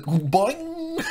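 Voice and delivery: high squeaky boing; cartoon boing. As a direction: make a cartoon spring noise. Cartoon sound effect.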